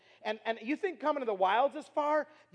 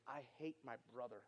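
low, very soft retold anger; retold angry snap; 10 semitones lower, 17 dB quieter than usual. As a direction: imitate a bitter confession, barely voiced. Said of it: "he just all of a sudden snaps up".

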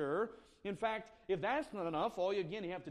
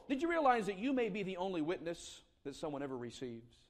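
ordinary talk

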